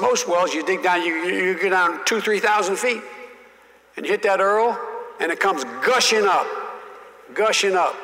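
Russell Long explaining. Retold or imitated. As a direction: talk it up high like an old Southern senator schooling a kid. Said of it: "he said".

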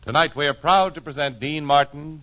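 usual speaking voice

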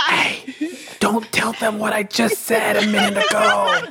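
high, slow mock whisper that carries; four-year-old's loud whisper. Co-host mocking John's attempts at whispering, loud kiddie stage whisper. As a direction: whisper badly, like a little kid. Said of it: "is like a four-year-old trying to whisper".

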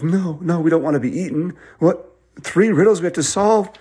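high-pitched mock alarm; alarmed kids. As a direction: protest in a high, panicky voice. Children alarmed at being eaten.

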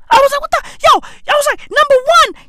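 very high-pitched, stammering disbelief; baffled squeal. Acted. Comedian reacting to the Merseyside accent, squeaky bafflement. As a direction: break off and restart way up high, baffled.

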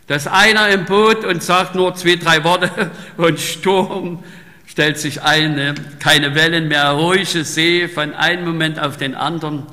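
loud, slow pulpit delivery; fervent sermon voice; forceful preaching. Acted. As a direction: preach it out to the congregation.

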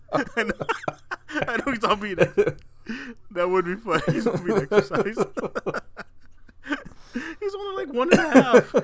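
high, helpless laughter through the words; laughing. Real, not acted. Picturing a toddler being exorcised, real laughing. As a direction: barely get it out for laughing. Cracking up about the exorcism joke.

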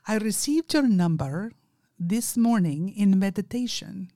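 imitated Indian accent, phrase by phrase; an earnest caller's Indian accent. Caller telling her he received her number, Indian accent. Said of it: "he has a very strong Indian accent".

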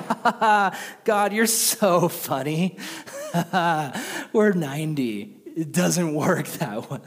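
put-on sarcastic laughing old couple; mocking laugh. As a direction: laugh God off as a joke. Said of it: "it says they laugh".

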